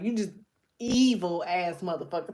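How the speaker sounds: ordinary delivery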